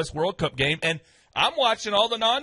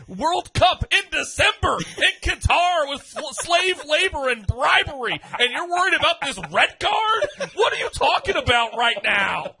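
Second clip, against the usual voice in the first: high, incredulous tirade; indignant rant; 7 semitones higher than usual. Acted. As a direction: rail at the critics, pitch climbing in disbelief. Mock-outraged rant at FIFA critics.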